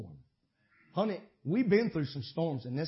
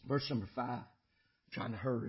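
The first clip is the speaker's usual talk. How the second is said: normal talking voice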